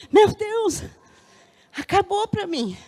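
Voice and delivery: high, loud cry of doom; despairing cry. Retold or imitated. Woman reliving her vision of hell, crying out in despair. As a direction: cry out that all is lost. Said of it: "Eu gritava lá".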